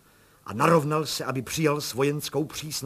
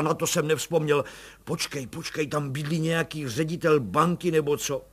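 put-on agitated old colonel, louder and higher; excited colonel; 4 semitones higher, 6 dB louder than usual. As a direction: bluster through it as the flustered colonel.

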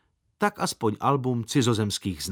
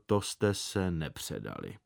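softer, lower muttered aside; inner thought; 6 semitones lower, 6 dB quieter than usual. Acted. Boy's silent sarcastic thanks for the gloves, quiet aside. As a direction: say it under your breath as a private thought. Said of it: "v duchu jsem pravil".

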